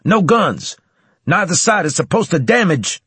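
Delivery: high, loud angry shout. Acted. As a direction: yell to stop the fight.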